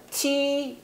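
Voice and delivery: normal talking voice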